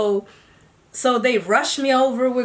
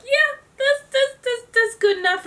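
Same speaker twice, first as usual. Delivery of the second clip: overwhelmed. Retold or imitated. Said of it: "And I was like".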